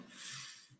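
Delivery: f as a held "fff"; letter sound. Shown as a demonstration. Teacher gives the new sound, f as "fff". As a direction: make the sound of f, not "eff". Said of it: "change to a new sound".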